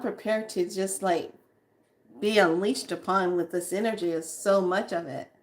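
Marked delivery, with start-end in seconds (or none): none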